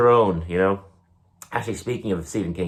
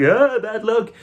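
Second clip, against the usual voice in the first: high, loud mock alarm; 6 dB louder, slower than usual. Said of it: "I'm like".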